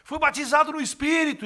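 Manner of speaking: high, loud joyful yell; retold ecstatic outburst, full voice; ecstatic shouting. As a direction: shout it out in rapture in the middle of the church. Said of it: "comecei a gritar".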